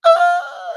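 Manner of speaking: very high, loud squealed cry; hammed-up falsetto wail; mock crying wail. Imitating cellmate wailing as if hit, mock crying. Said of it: "he starts crying".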